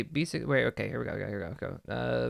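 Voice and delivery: ordinary talk, nothing marked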